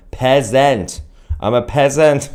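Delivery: mock-accented PEASANT, high and drawn out; mocking unknown accent. Streamer quoting the man who called him peasant, mock accent. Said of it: "what accent that was".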